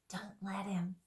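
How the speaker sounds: urgent hushed whisper. Acted. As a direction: lean in and whisper a warning. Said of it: "whispered Trumpkin to Peter".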